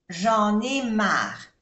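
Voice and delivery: "J'en ai marre" very slow, linking j'en into ai; liaison. Shown as a demonstration. Teacher showing liaison in "J'en ai marre", slowly. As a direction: glide "J'en ai" together as one. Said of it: "Hacemos la unión, la liaison".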